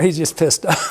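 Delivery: ordinary talk, nothing marked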